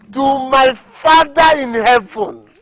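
loud, high, slow declaration; solemn oath. Acted. Seller swearing innocence to God, solemn oath. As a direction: proclaim it slowly to the heavens.

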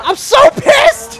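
real anguished yelp, drawn out; exasperated cry. Real, not acted. Frustrated watching teammate lose.

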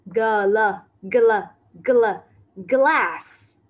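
teacher drills the gl in "glass."; sounding out. Shown as a demonstration. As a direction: build "G L, G L, G L, glass." up to the whole word. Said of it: "là glass".

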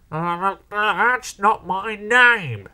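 slow, high, indignant splutter; stammer, then protest in outrage; loud, strained indignation; spluttering angrily. A narrator's reading. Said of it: "the diesel spluttered angrily".

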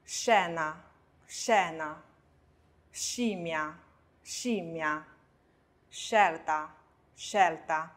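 scena, scimmia, scelta starting with sh; very slow model of scena, scimmia, scelta, sc hushed; scena, scimmia, scelta as SHE-na, SHIM-mya, SHEL-ta. Shown as a demonstration. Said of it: "我们看这两个音节的发音练习".

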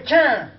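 loud comic sound effect; cartoon impact sound; punchy "tchan!". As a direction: bang out "tchan!".

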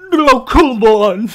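loud, slow, high monster voice. Acted. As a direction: boom it like a goofy monster. Monster speaks.

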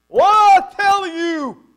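booming, high, drawn-out Italian shout; irate Italian bellowing through the house; Italian accent yell. Retold or imitated. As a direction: holler it loud and slow with an Italian accent. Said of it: "That's Italian".

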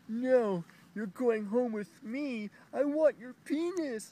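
high, loud, slow mocking female voice; hammed-up demanding ex; mocking ex girlfriend. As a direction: caricature her insistent whine. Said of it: "trying to drag me inside".

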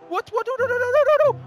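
rapid squealed noes; alarmed high squeal; high panicked no-no-no. Real, not acted. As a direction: panic in a high-pitched stream. Panicking in play.

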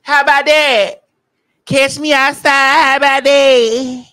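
loud, high mimicry with drawn-out words; Bad Baby catchphrase. Host mimicking the bad baby girl for co-host, loud catchphrase impression. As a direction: belt out the viral catchphrase, high and stretched. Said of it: "The bad baby girl".

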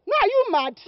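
angry shout. Acted. Girl yelling at the man who stopped her. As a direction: bite his head off.

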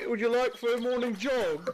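put-on light posh British accent, lifted high; a teasing British gent hailing joggers; mock British accent. Mock British accent to strangers.

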